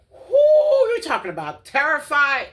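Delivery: high, loud disbelieving cry; incredulous exclaim. Real, not acted. Telling viewers how scared she was, loud and incredulous. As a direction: throw it out in disbelief. Exclaiming about her fear.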